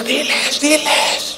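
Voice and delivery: quietly pressing the leader from below; urgent hushed urging. Retold or imitated. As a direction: hiss up from the front row.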